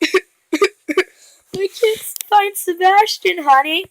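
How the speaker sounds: high, very slow put-on mom voice; hammed-up motherly distress. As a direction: draw it out high and pitiful. Mom's reply, voiced in story.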